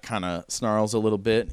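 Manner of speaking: normal talking voice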